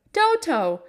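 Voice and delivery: high call after the running dog. A narrator's reading. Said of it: "called Dorothy".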